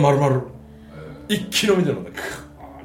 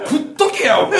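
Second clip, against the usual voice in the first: 1 mock scolding shout; 9 semitones higher, 6 dB louder than usual. 叱るように言った自分の台詞.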